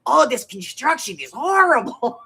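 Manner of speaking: slow, lightly voiced cashier complaint; a hint of the tutting register lady; sympathetic cashier. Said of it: "She goes".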